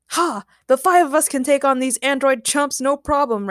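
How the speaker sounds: boasting. A narrator's reading. Ray sizes up the androids, boasting. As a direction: brag that it will be easy. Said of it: "Ray boasted".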